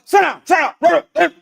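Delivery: loud, high, slow shouted command; mock-stern teacher shouting at class; firm teacher command. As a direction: bark it like a strict teacher. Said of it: "the teacher were to go".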